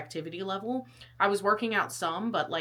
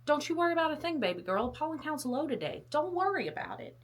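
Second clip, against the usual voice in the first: lightly voiced sweet-talking app, higher; voicing the app; 6 semitones higher, 5 dB quieter than usual. Pollen app reassuring her, mock sweet voice. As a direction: coo it like a friend reassuring her. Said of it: "and it was like".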